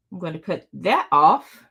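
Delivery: ordinary talk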